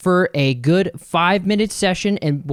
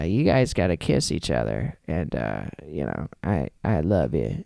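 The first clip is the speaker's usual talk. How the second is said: low, soft, halting mumble; Adam Sandler; 11 semitones lower, 6 dB quieter than usual. Acted. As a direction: imitate a bashful, serious speech. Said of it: "no way that he was just being".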